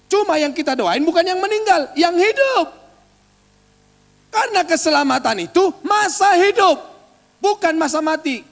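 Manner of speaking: loud, high pulpit proclamation; preacher booming the promise at the church; fiery preaching. Acted. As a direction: thunder the promise to the congregation. Preaching at full force.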